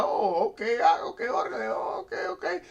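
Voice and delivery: breathy, startled okays with a high squeak at the end; mock-surprised, caught off guard; taken-aback okay. Judite meeting Issacharites, imitating surprised realization. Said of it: "you're like".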